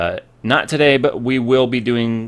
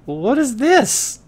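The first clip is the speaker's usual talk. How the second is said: high, slow, astonished squeal; amazed kid; 14 semitones higher, much slower than usual. Retold or imitated. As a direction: stretch it out in disbelief. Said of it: "we're like".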